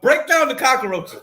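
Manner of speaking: high, loud exclamation; insistent demand. Real, not acted. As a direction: push them hard for an answer. Presses co-host for an explanation.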